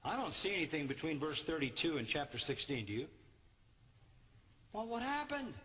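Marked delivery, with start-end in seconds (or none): 4.7-5.5 mock surprise. Preacher feigning puzzlement at the abrupt ending, surprised. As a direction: act baffled the story stops. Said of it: "repeated feigned puzzlement".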